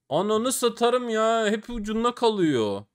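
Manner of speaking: high, slow exasperated wail; whining at bad luck; frustrated cry. Real, not acted. Upgrade missed again.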